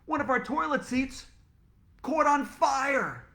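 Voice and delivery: panicked shout. Acted. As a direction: drag out the disaster in a yell. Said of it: "shouted Mister Klutz".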